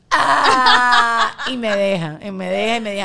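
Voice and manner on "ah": a loud, sharp gasp of relief; mock gasp of relief. A wife tells how her husband lets her vent, loud gasping cry. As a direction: gasp out loud as if finally heard.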